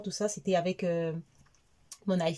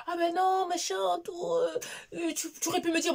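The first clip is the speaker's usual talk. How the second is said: much higher mocking husband voice; mock husband protest; 12 semitones higher than usual. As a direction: parody his complaining. Imite les reproches du mari. (parodie le mari qui proteste, voix aiguë)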